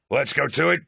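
loud, high rallying call; a commander's battle cry; rousing cry. A narrator's reading. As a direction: fire up the officers. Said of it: "Loken cried".

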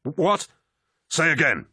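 high, sharp shouted query; urgent radio shout. A narrator's reading. Embattled warrior answering the vox, high urgent shout. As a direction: shout back over the vox. Battlefield radio exchange under attack.

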